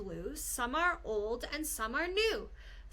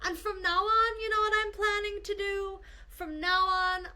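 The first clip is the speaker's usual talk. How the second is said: eager vow; 6 semitones higher than usual. Acted. Kangaroo's vow.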